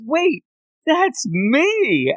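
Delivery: hammed-up wait that's me; a retold startled realization; sudden realization. Retelling Denji's realization again.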